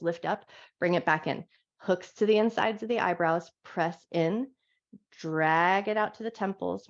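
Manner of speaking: calm, gentle guiding voice through each stretch; guided yoga instruction. Acted. Yoga teacher guiding her class through a wake-up stretch, calm guided voice.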